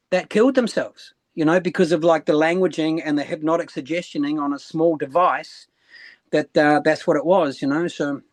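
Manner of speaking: usual speaking voice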